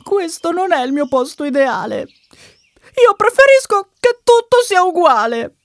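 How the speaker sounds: put-on high, upset child voice, rising; frightened little brother. Scared brother wants everything the same, frightened protest. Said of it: "il fratellino è davvero impaurito".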